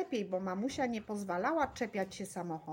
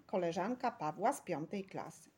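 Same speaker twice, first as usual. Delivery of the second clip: usual speaking voice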